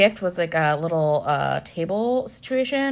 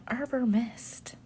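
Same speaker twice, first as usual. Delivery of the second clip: whisper; 4 semitones higher, 8 dB quieter, slower than usual. Real, not acted. Confessing cheap wine.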